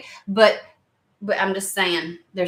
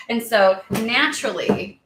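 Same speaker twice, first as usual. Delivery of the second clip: usual speaking voice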